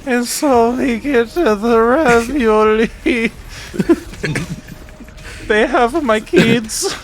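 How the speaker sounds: a dull, slow cartoon Italian plumber; Mario voice. Mario on a cooking show, in a slow high hammed-up voice. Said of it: "Mario, we're not fixing your voice".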